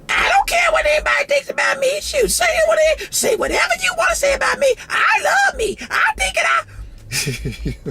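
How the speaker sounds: put-on very high, shouted, squeaky kid-sister boast; mock little sister. Kid sister bragging to everyone, squeaky high shout. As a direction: shriek it like a cocky kid sister showing off her braids.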